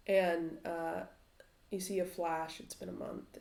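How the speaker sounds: ordinary talk, nothing marked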